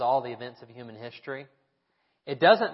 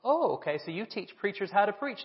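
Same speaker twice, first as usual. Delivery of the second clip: lightly imitated, higher Tennessee twang; a hint of the folksy Tennessee local, pitched up; Tennessee accent; 7 semitones higher than usual. Old gentleman sizing up the seminary professor, light Tennessee accent. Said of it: "with a good Tennessee accent".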